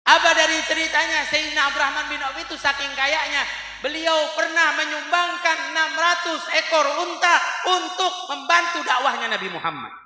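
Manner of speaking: loud, high preacher's proclamation; full-voiced sermon delivery, easing down at the end; impassioned preaching. Real, not acted. Preaches at raised force.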